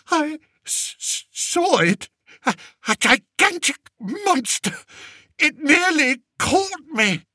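very high, stuttering terror; stammering fright. A narrator's reading. Terrified king telling lords of monster, stammering. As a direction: stammer out the horror. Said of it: "moaned Fred".

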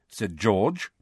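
plain reading voice

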